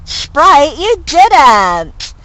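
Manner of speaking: high, loud scolding cry; hammed-up dismay at a pet; mock scolding. Reading character scolding owl.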